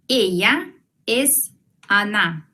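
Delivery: Ella es Ana said slowly, each word apart; slow teacher demo; slow model sentence. Shown as a demonstration. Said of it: "Miren la transcripción". (despacio y claro)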